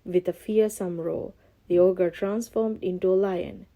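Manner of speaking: ordinary delivery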